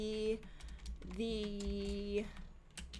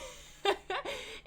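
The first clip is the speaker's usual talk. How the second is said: high giggle; 12 semitones higher than usual. Real, not acted. Laughing at her Sim.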